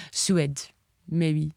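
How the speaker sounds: mock-French accent; slight French accent. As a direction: imitate someone with a bit of French accent. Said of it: "with a wee bit of a French accent".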